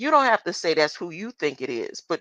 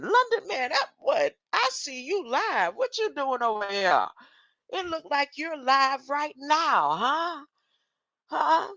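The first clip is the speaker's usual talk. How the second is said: excited greeting; 9 semitones higher than usual. Real, not acted. Excitedly greets viewer London man.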